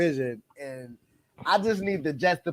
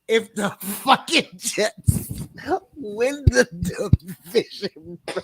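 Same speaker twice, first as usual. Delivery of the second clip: emphatic declaration; 4 semitones higher, 4 dB louder than usual. Real, not acted. Emphatic hyped fan declaration.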